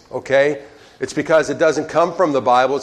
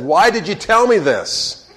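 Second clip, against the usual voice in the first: imitating a dismayed wife, pitched higher; mock-distressed demand, raised in pitch; upset wife; 6 semitones higher than usual. Wife to husband who just told her the cat died, mock upset.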